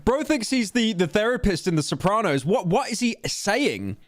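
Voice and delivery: high-pitched, baffled disbelief; incredulous. Real, not acted. Streamer reacting to a prying interviewer, incredulous. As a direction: exclaim it in high, stretched-out disbelief.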